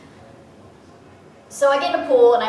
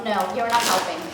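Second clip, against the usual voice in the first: ordinary talk, nothing marked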